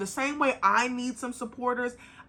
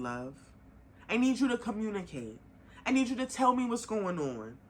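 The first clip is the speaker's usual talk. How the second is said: ordinary talk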